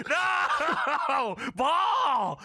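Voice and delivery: cracking up mid-shout; laughing mock shout. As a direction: yell in hammed-up grief. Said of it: "Kane says".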